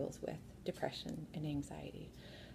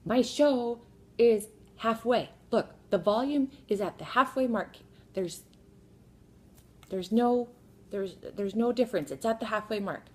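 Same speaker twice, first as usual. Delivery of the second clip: loud, raised-pitch literal-minded son; imitated yelling; 5 semitones higher, 9 dB louder than usual. Son defending his tablet volume to his sister, yelling. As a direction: insist loudly, imitating a yelling kid. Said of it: "because he doesn't stop yelling at her".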